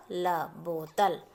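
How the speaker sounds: बोतल built up slowly; slow spelling out. Shown as a demonstration. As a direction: drag out the final syllables. Teacher spelling words for children. (बोतल धीरे धीरे जोड़कर)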